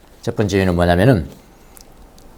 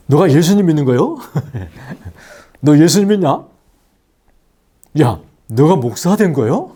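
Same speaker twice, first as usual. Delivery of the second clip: surprised exclaiming; 5 semitones higher than usual. Retold or imitated. Reunited friends exclaim in surprise.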